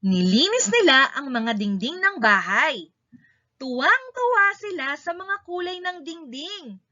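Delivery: usual speaking voice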